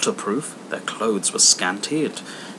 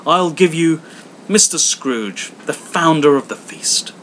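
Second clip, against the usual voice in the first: higher, loud cheery toast; Bob's toast; 6 semitones higher, 7 dB louder than usual. Acted. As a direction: raise a glass to him. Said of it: "Bob proposes the toast".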